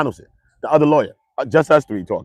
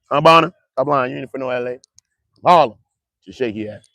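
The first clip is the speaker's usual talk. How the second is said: ordinary talk, nothing marked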